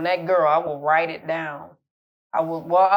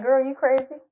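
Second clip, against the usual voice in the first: incredulous friend; 8 semitones higher than usual. Retold or imitated. Friends react to snooping request.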